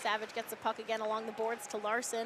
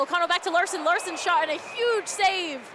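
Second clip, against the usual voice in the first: excited call; 7 semitones higher, 7 dB louder than usual. Real, not acted. Big save call.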